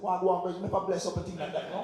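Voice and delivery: laughing Jamaican accent, high and loud. Shown as a demonstration. Asked at an audition to do it in a Jamaican accent, playful Jamaican accent. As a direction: read it Jamaican, grinning through it. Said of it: "Can you do it with a Jamaican accent?".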